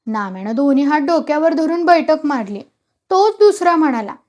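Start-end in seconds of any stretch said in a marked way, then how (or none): none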